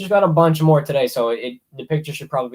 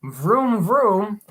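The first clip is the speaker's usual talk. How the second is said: higher, drawn-out vroom noise; mock chainsaw revving; 5 semitones higher than usual. Streamer introducing the chainsaw remake, playful imitated revving.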